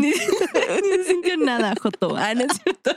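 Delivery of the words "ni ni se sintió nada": laughing echo. Real, not acted. Echoes friend's line, laughing.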